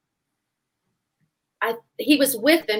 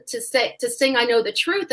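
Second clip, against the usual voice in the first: ordinary talk, nothing marked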